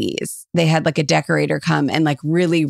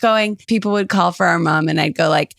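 normal talking voice

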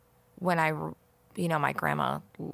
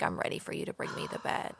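soft whisper, a touch faster; whispered quote; 9 dB quieter, faster than usual. Retold or imitated. Retelling grandma's last words, whispered. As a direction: whisper the dying grandma's request. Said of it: "she was like".

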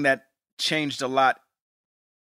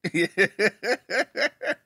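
real laugh; 7 semitones higher than usual. Real, not acted. Host laughs at the impression.

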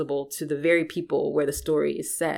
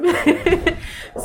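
high, loud real laugh; amused laugh; 14 semitones higher, 6 dB louder than usual. Real, not acted. Author joking about parents' love and hate, laughing. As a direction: laugh at your own admission.

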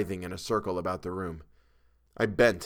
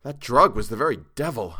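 plain reading voice

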